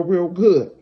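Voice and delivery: ordinary talk, nothing marked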